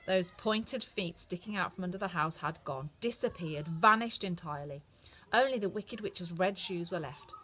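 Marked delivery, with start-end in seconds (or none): none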